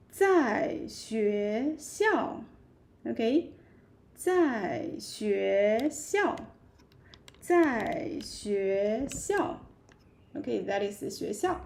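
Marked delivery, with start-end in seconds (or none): 0.1-2.4 each syllable spaced out slowly; slow model phrase. Shown as a demonstration. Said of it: "You can say". 4.1-9.6 each syllable spaced out slowly; slow model phrase. Shown as a demonstration. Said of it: "You can say".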